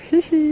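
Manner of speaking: high, loud tittering giggle. Real, not acted. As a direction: giggle with glee.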